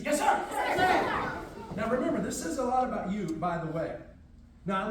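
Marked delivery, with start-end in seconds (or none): 0.0-1.3 high, loud "Yes, sir!" snapped out; military shout. Shown as a demonstration. Instructor models the reply for seated students, loud and high. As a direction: answer like a soldier. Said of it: "Everybody have a seat".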